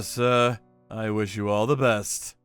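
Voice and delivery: usual speaking voice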